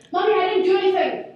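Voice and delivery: high, loud, hurried denial to mom; child protesting innocence. Retold or imitated. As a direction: imitate a small daughter rushing to deny it. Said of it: "comes running to me, running straight up".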